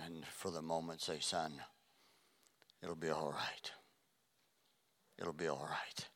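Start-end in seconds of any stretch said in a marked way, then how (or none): 1.2-3.7 soft, low and gentle reassurance; tender comfort. Pretend. Father holding son with crushed fingers, softly reassuring. 5.2-6.1 whispered comfort. Pretend. Father whispering comfort to son with crushed fingers.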